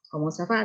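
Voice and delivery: Comment ça va? with va rising; rising question tune. Shown as a demonstration. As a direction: end Comment ça va? on an upward glide. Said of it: "harus dengan nada yang naik". (Comment ça va? bernada tanya, naik di va)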